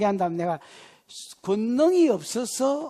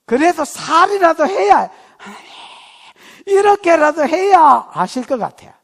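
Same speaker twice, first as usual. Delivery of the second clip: 크게 외치는 기도 흉내; 7 semitones higher, 12 dB louder than usual. Retold or imitated.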